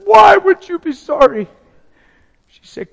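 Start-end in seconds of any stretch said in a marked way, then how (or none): 0.0-1.5 high, loud, slow tearful question; loud high-pitched anguished plea; wailed disbelief. Retold or imitated. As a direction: cry it out, high and drawn-out. Husband wails back at wife.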